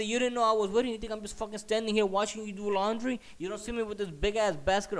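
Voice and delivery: high, loud incredulous rant; re-enacted furious confrontation; really mad. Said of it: "I'm getting really fucking mad".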